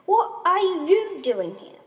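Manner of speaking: high, slow, loud demand; acted screamed question. A narrator's reading. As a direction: shout it slowly in disbelief. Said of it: "screamed Ascendia very loudly".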